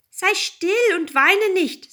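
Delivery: high, loud frog character voice; calling frog. A narrator's reading. Frog calls to the weeping princess, high and loud. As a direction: call out high and drawn-out. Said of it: "sagte der Frosch".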